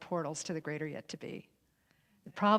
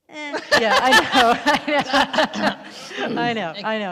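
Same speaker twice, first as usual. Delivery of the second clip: laughing; 6 semitones higher, 13 dB louder, slower than usual. Real, not acted. Laughing agreement with Linda.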